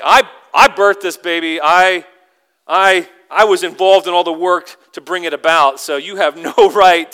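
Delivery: mock-outraged new mother, slight laugh; indignant mother. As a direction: stand your ground as the mom, loudly. Acted mother protesting being ignored.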